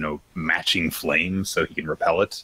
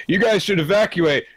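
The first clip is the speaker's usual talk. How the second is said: much higher, louder urgent command; urging order; 13 semitones higher, 3 dB louder than usual. Retold or imitated. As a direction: warn them to get out now. Captain orders them out.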